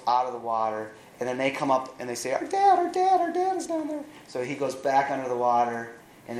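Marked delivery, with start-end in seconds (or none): 2.3-4.0 put-on very high, loud child's cry for help; panicked little girls. Two girls pulled from the water cry out for their dad in panic. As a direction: squeal it like a frightened little girl.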